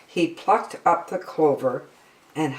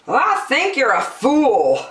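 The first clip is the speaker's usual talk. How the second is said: mocking kangaroo; 9 semitones higher, 4 dB louder than usual. Sour kangaroo mocks.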